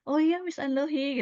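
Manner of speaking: acted delighted reply. Answering Miss Andohi's compliment on her face, pleased. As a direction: play it bright and eager, answering praise.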